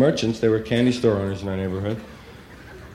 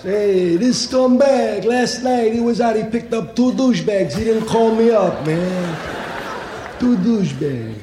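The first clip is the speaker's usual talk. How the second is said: high, slow New York Italian wiseguy; Italian street guy; 11 semitones higher, 3 dB louder, slower than usual. Retold or imitated. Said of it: "Those who know will attest".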